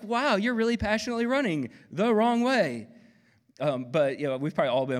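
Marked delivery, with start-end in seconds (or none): 0.0-2.9 high, loud sarcastic wonder; teasing friends running the wrong way; mock amazement. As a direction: marvel sarcastically at misplaced zeal. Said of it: "it's like".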